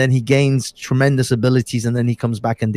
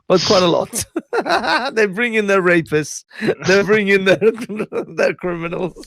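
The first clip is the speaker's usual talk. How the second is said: laughing mock quote; 7 semitones higher than usual. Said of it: "Trump-style quote, laughing".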